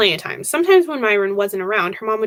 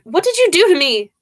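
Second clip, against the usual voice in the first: sharp, higher-pitched accusation; snapped; 7 semitones higher, 4 dB louder than usual. A narrator's reading. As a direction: snap it at him, cutting in. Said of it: "Angel snapped before he could finish".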